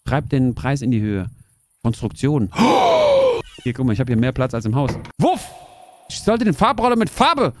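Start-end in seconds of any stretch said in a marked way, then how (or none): 2.6-3.4 high, sharp gasp of surprise; loud gasp. Real, not acted. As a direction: gasp as if startled. (lautes, hohes Aufkeuchen)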